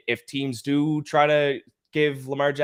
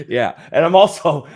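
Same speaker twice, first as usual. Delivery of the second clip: louder, laughing through the words; 2 semitones higher, 11 dB louder than usual. Real, not acted. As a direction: laugh as you answer the joke. Laughing at host's flu joke.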